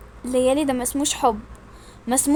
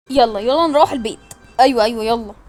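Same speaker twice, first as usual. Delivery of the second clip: ordinary talk, nothing marked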